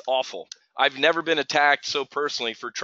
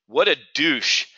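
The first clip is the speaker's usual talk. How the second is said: mock sneer; slower than usual. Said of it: "That's what I get called".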